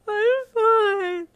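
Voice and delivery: very high, loud wail. Acted. Patient insisting to mum, loudly wailing. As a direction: wail it out at the top of your voice. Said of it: "you wailed".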